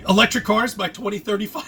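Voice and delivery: ordinary talk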